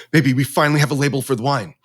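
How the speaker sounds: quick, high burst of excitement; excited news. Retold or imitated. Husband bursting to tell his wife about the label, excited. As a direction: rush it out to his wife, thrilled.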